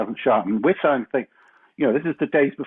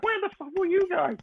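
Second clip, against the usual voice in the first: high, indignant where-were-you; mock outrage at late friends; high-pitched indignant demand; Lenny's indignant shout; 3 dB quieter than usual. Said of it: "and he's like".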